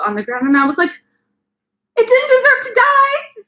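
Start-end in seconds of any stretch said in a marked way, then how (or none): 1.9-3.4 high, loud teary wail; mock crying wail. Mourning the dead spider, a high teary mock wail. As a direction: wail over the poor spider.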